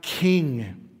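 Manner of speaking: each word slow and weighty; God is my king read slowly and deliberately. Shown as a demonstration. Preacher reading the verse to his church, slow and weighty. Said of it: "Verse three, it says".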